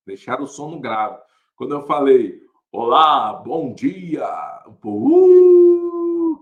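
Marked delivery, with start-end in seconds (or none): 2.7-4.3 deep, loud announcer's greeting; booming bass voice. Acted. Shy narrator greeting the school through bassy speakers, deep booming voice. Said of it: "que saiu grossão". 4.7-6.4 long, high, loud "uuuh"; crowd's whoop. Retold or imitated.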